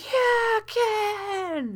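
high, drawn-out cheer; team cheering. Acted. Game master voices teammates crowding round after a goal, high cheering. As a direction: cheer like a team mobbing its scorer. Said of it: "crowds around you and is like".

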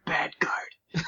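unvoiced aside "bad guard"; whispered aside. Tells his partner the plan in a whisper. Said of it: "good guard".

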